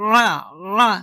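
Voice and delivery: "রা" said as throat-pressed "ʿaa"; ayn sound. Shown as a demonstration. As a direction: squeeze mid-throat for "রা", no rolled r. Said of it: "আইন উচ্চারণ করতে হবে".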